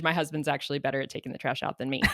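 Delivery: fast laughing aside; real laughter while correcting the story. Real, not acted. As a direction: chuckle through the disclaimer. Laughing disclaimer to husband.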